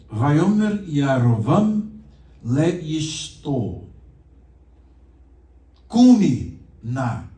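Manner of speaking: slow, loud, deliberate Hebrew reading; Hebrew read aloud. Shown as a demonstration. Teacher reading the Hebrew text slowly for students. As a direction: sound out each Hebrew word carefully. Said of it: "Esse na é uma partícula".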